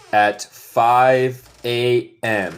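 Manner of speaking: slow, clear teacher modeling; slow model sentence. Shown as a demonstration. Teacher modeling a sentence for students, slow. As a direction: spell it out slowly for the class. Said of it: "¿y cómo decimos despertarse en inglés?".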